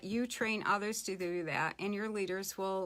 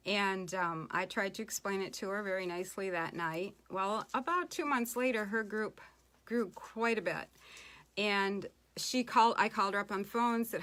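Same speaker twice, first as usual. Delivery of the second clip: usual speaking voice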